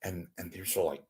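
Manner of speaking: ordinary talk, nothing marked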